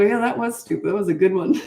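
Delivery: retold playful agreement, about to laugh; amused agreement. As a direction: tease along with an amused lift. Laughing along with clients' mistakes.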